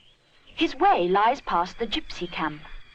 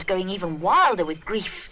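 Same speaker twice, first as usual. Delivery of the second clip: plain reading voice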